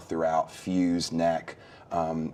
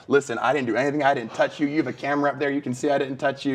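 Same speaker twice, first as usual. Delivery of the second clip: higher, louder insisting; animated denial; 7 semitones higher, 4 dB louder, faster than usual. Retold or imitated. Telling the astonished driver he never touched him. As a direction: protest with amused energy. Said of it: "I'm like".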